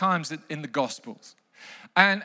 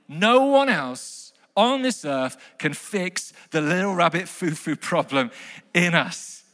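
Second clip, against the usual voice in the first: ordinary talk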